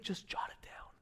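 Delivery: unvoiced, hushed advice; whisper. Real, not acted. Pastor whispering advice to the congregation. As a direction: lean in and breathe it. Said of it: "when God begins to whisper".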